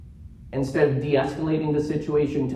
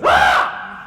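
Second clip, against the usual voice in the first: loud harsh scream; retold scream; 11 semitones lower, 10 dB louder than usual. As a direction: scream back in her face. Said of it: "So I got in her face".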